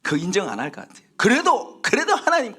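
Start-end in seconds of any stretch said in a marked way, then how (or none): 1.2-2.6 very high, insistent objection; mock protest. Preacher imagines himself arguing with God, protesting. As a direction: protest in a much raised pitch.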